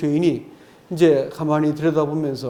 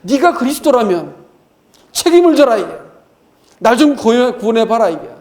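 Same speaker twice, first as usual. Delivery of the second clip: higher, much louder furious demand; shrieking rant; 5 semitones higher, 7 dB louder than usual. Retold or imitated. Dying robber rails at Jesus, higher and louder. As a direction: yell it up at him, higher and louder. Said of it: "구원자에 대해서 악다구니를 하면서".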